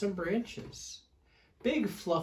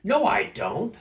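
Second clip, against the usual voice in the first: higher, louder refusal; arguing back; 8 semitones higher, 8 dB louder than usual. Acted. Smelly Bear argues back, higher and louder. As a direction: push right back. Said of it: "said Big Smelly Bear".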